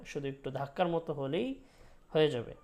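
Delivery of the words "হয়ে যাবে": usual speaking voice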